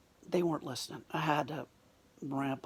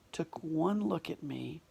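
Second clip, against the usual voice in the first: ordinary talk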